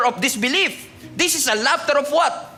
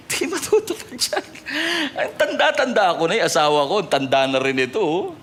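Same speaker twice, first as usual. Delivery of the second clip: [name] laughing. Acted. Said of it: "tumatawa si [name]".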